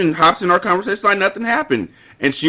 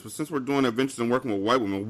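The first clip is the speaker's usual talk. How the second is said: ordinary delivery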